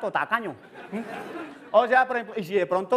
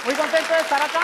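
mock gala speech; 5 semitones higher, faster than usual. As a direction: thank the audience grandly. (discurso de gala en broma)

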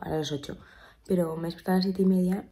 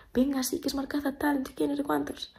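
higher-pitched, mock-breezy agency rep; imitating agency rep; 7 semitones higher than usual. Agency rep talking her into the event, imitated pushy coaxing. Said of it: "Y María, la de la agencia".

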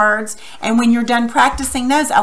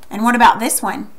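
normal talking voice

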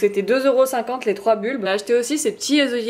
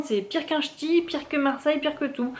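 high-pitched, softening exasperation; high, helpless exasperation; exasperated complaint; 5 semitones higher, 4 dB quieter than usual. Real, not acted. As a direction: pile up the complaint in a higher voice. Exasperated rant about a strong accent.

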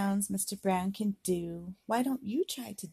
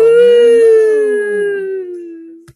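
high, loud, slow drawn-out moo; hammed-up cow call; 12 semitones higher, 20 dB louder than usual. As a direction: stretch the moo like a big cow. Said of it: "He can sound like a cow".

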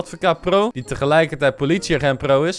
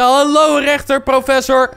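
calling out; 9 semitones higher, 4 dB louder, slower than usual. Real, not acted. Greeting judge across room.

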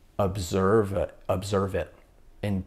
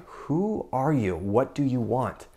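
slow, higher-pitched challenge to the voice; confronting demand; 4 semitones higher, slower than usual. Retold or imitated. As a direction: draw out the questioning, demanding an answer. Confronts the voice in retold scene.

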